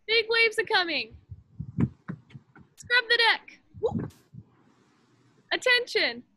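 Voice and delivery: put-on calling out commands to a room of kids; game caller. Leader running Captain's Orders game with kids, called out.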